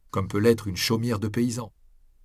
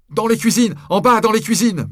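very high, urgent shout; crying out; 13 semitones higher, 10 dB louder than usual. A narrator's reading. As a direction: yell directions in an emergency. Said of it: "m'écriai-je".

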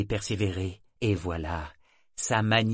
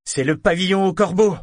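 loud, high, thrilled cry; delighted exclamation; 9 semitones higher, 10 dB louder than usual. Acted. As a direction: shout it out with glee. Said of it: "s'exclama-t-il d'un ton ravi".

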